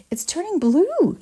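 high, slow horrified cry; screaming mother. A narrator's reading. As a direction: scream it in horror. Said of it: "screamed Missus Beauregard".

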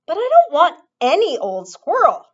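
high, loud spoiled shout. A narrator's reading. Veruca shouting at her mother, high and loud. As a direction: yell it back like a brat. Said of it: "Veruca shouted".

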